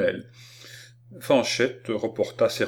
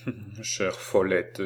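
put-on slow, tender old man; moved stammer; 3 dB quieter, slower than usual. A narrator's reading. Old man moved by his granddaughter's caress, faltering tenderly. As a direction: murmur it fondly, a little overcome. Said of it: "balbutia le vieillard, ému par cette caresse".

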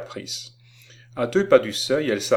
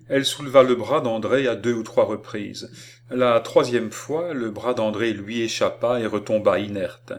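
usual speaking voice

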